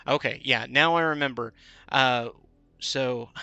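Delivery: usual speaking voice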